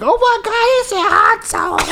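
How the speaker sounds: very high, loud drawn-out squeal; excited woman mimicked. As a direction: squeal it slowly like a delighted onlooker. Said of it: "And then she was like".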